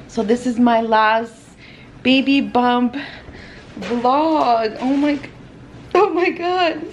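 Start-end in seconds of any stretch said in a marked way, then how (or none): 4.8-6.9 pained, higher cry from heartburn. Real, not acted. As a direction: wince through the heartburn. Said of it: "Sorry, I have, like, heartburn still".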